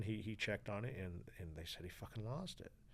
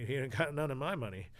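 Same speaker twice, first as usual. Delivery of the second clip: mock innocent denial, a bit louder; playing dumb; 6 semitones higher, 7 dB louder, faster than usual. To the jailer on the phone, playing dumb. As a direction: feign total ignorance, laughing it off. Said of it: "I'm playing stupid".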